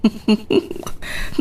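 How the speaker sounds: breathy gloating snicker. Acted. Gloating over the ex-wife.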